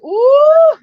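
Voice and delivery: high, loud thrilled ooh; loud delighted ooh for a viewer; excited ooh. Retold or imitated. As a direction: read the viewer's comment as a big ooh. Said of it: "Elkie says".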